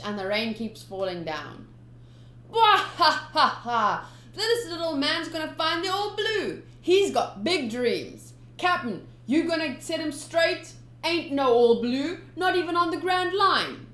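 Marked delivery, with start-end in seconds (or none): 2.5-13.9 put-on a pirate laughing at a kid's dream; mocking pirate laugh. Pirate crew mocking the boy, loud laughing jeer.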